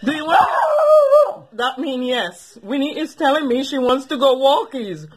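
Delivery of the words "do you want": much higher, drawn-out coo to a dog. Real, not acted. Owner baby-talks to her dog.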